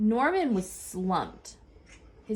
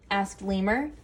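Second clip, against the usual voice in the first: usual speaking voice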